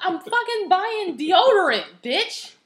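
loud, high, slow indignant rant; hammed-up fury; mock outraged yell. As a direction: yell it at the thieves.

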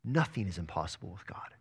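an unvoiced, hushed whisper. Shown as a demonstration. Preacher whispering the angel's promise to the congregation, hushed whisper. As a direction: breathe the promise out, barely voiced.

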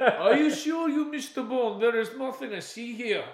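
loud, slow, laughing mock Russian villain; bad Russian accent. Mocking the villain's Russian accent to a friend, loud and laughing. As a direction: ham up a clumsy Russian accent. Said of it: "He's like".

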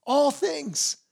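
awed stress on All. Shown as a demonstration. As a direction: hit All, then let it fall.

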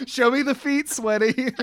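high giggly teasing line; laughing tease. Acted. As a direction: crack up while teasing.

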